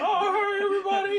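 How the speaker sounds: high, loud Muppet voice; hammed-up puppet greeting; Kermit. Friend mocks an actor as a Muppet greeting everybody, high and silly. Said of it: "He sounds like Kermit".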